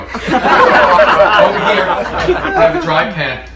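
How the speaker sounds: laughing through the words. Real, not acted. Chef showing his pan to viewers, laughing. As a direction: chuckle while showing the pan.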